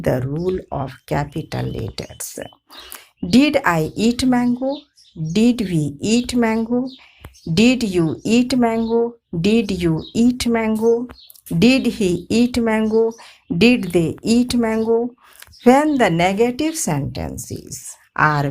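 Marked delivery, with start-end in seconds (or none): 3.3-15.1 higher, rising question reading; question tune. Shown as a demonstration. Teacher reading interrogative examples to students. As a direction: ask each one as a real question. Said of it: "make the affirmative sentences interrogative".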